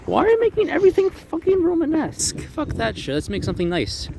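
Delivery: high, mock-exasperated architect pitching glass and steel; imitating Mies van der Rohe. Architect scoffing at Romanesque buildings, high mocking voice. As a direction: squeak it out like a fed-up modernist, pitch way up. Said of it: "and he was like".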